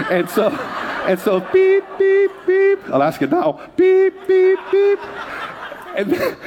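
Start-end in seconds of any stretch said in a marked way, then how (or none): 1.4-2.8 shrill mechanical beep beep; scanner beep. Retold or imitated. Imitating scanner beeps. 3.8-5.0 high loud beeping; shrill mechanical beep beep; scanner beep. Retold or imitated. Imitating scanner beeps.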